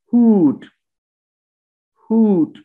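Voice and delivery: हूट said high with a long oo. Shown as a demonstration. Teacher drills the long oo in हूट. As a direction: hold the oo in हूट. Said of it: "ये ऊ लंबी sound है".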